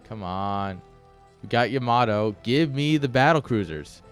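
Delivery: ordinary talk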